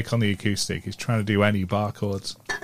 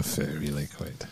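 low, soft, slow ad announcer; deep ad voiceover; 9 semitones lower, 14 dB quieter, slower than usual. Retold or imitated. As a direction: murmur it like a TV ad voice. Echoing the ad in a low voice.

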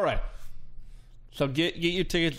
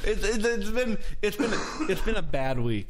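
laughing; 6 semitones higher than usual. Real, not acted. Laughing while speaking.

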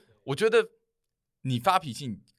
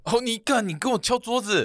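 mock outrage; 11 semitones higher than usual. Teasing friend who banged the counter, high mock outrage. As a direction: act scandalized at the table banging.

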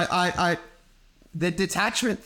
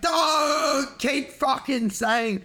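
mock frustrated snap; 5 semitones higher, much slower than usual. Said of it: "grab their hair and go like".

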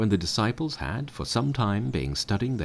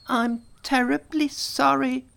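very high, sorry little monkey; high apologetic monkey; contrite Monkey; 5 dB louder than usual. A narrator's reading. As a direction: beg forgiveness.

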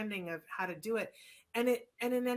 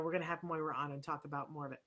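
normal talking voice

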